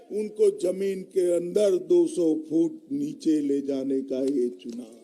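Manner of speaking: normal talking voice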